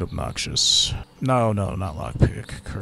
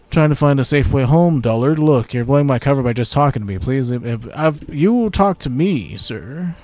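lightly acted spy, louder and irritated; exasperated disguised spy; 5 semitones higher, 5 dB louder than usual. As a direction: plead to be left alone, raised voice.

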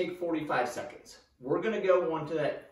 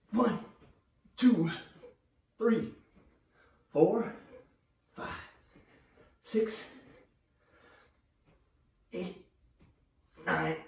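doing push ups; 2 semitones higher, slower than usual. Real, not acted. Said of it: "Push-ups and counting out loud and talking, challenging to do".

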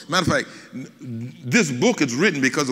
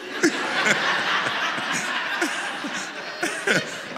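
real laughter; 13 semitones higher than usual. Real, not acted. Laughing after daughter's line.

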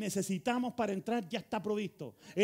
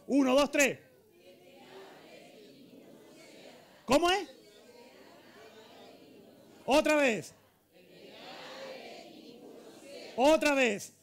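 loud, high call to a crowd; short shouted cues from the pulpit; rousing shout; 7 semitones higher, 4 dB louder, slower than usual. Real, not acted. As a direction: drive the congregation to answer louder. Cues congregation to read aloud together.

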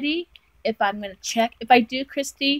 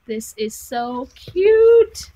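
loud, slow, drawn-out gush; gushing delight; 3 semitones higher, 9 dB louder, much slower than usual. Real, not acted. Gushing over a gift she will treasure, loud delight. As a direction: draw it out, swelling on the last word.

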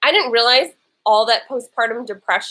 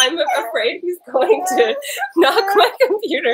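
words breaking into laughter, near tears; laughing half-crying; 7 semitones higher than usual. Real, not acted. Mom laughing almost to tears as her son shakes the computer. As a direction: laugh helplessly through the worry.

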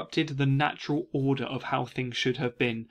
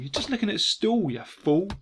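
higher, exasperated telling-off; mock scolding; 6 semitones higher than usual. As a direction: tell him off for being foolish. Paraphrases wife mocking his fear.

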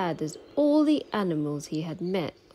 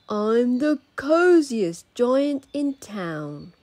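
slow, louder, proud declaration; happy cry; 5 semitones higher, 5 dB louder, much slower than usual. A narrator's reading. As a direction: announce it with cosy pride. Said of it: "he cried".

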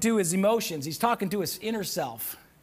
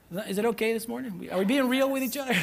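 ordinary talk

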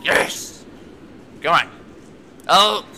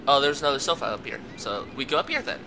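ordinary talk, nothing marked

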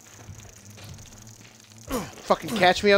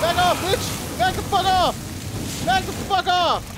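panicked yelling; 12 semitones higher than usual. Real, not acted. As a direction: yell at it to back off. Fighting off the enemy.

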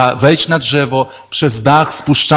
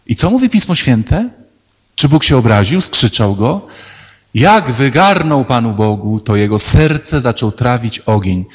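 ordinary talk

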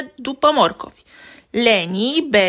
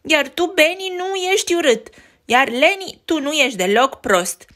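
Betty indignant; 6 semitones higher, 3 dB louder than usual. Acted. Betty defends her brothers.

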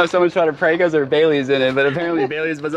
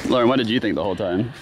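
ordinary talk

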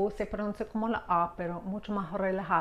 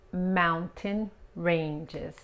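"Mountain ranges" again very slowly, calmer; slow repeat; much slower than usual. Shown as a demonstration. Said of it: "repeated slow model". ("Mountain ranges" lento y tranquilo)

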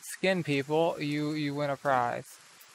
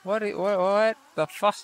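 high, loud disbelieving what the fuck; baffled outburst; 5 semitones higher, 8 dB louder than usual. Retold or imitated. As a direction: blurt out your shock, voice jumping up. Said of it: "And I'm just like".

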